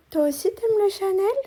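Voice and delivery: high cooing voice to a pet; baby talk. Acted. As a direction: coo at the little dog, rising. Cooing to her dog. (voix bêtifiante pour le chien)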